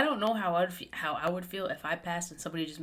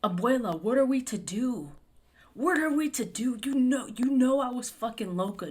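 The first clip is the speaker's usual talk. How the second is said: hammed-up melodramatic movie hero, pitched up; mock cheesy movie character; 4 semitones higher, 3 dB louder than usual. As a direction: parody a clichéd biopic lead begging his grandma. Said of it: "main character, bro, talks like this".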